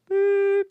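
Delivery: high, loud beep made by voice; censor beep. Pretend. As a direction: mimic a high-pitched censor tone. Censoring a word while reading aloud.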